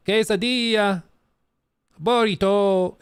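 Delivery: put-on loud, high, overdone Spanish accent; mock Spanish accent. Diner ordering at a Mexican restaurant, hammed-up Spanish accent. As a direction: ham up the Spanish accent on each food word. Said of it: "Mexican restaurant and they're like".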